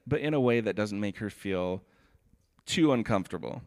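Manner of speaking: ordinary talk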